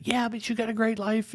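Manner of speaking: hammed-up cheery reassurance; dismissive upbeat dad. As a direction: chirp it like a dad who wants to fix things fast. Dad brushing off son's sadness.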